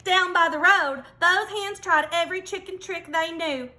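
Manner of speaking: usual speaking voice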